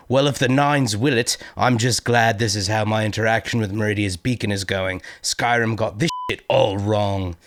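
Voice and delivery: put-on a Skyrim Nord accent, slowing down at the end. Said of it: "I can't not do the accent".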